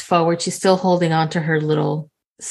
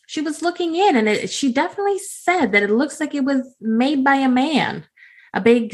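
high, sing-song flirty southern lady; flirty Belinda; 5 semitones higher than usual. Acted. Belinda sweet-talking the farmer, high and coy. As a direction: coo his name up high like a flirt.

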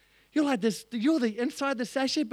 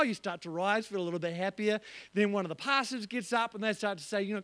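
ordinary delivery

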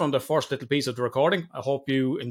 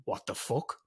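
unvoiced mock whisper of "What the fuck?"; 4 semitones lower, 9 dB quieter, slower than usual. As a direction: breathe "What the fuck?" in shock. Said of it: "and Mel says".

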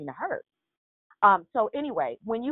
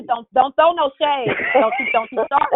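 louder, higher mock protest; playful scolding; 6 semitones higher, 8 dB louder than usual. Real, not acted. Teased by a friend about the gym story, mock scolding. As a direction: fend off the teasing, high and loud.

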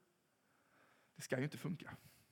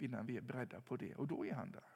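ordinary talk

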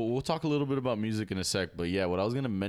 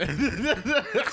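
loud, high burst of laughter; 6 dB louder than usual. Real, not acted. Laughing after attempt.

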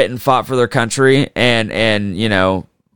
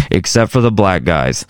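lower-pitched muttered caveat; mock aside; 6 semitones lower than usual. General Pershing adds a caveat to the side, mock aside. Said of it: "turned his face to the side".

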